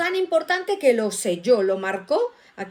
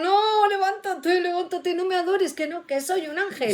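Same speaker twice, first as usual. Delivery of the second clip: high, pleading mock protest; acted urgent protest; 6 semitones higher than usual. Angel telling a worshipper to get up, acted urgent protest. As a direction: urge them up in hammed-up alarm.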